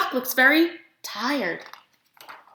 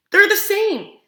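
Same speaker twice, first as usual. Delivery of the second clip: normal talking voice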